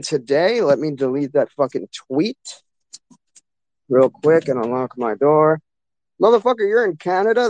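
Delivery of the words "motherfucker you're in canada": surprised. Real, not acted. Friend reveals he is in Canada.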